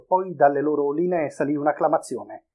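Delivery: ordinary delivery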